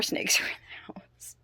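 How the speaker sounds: hushed, secretive whisper. Real, not acted. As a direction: breathe it like a spooky secret. Ominous question about hidden snakes.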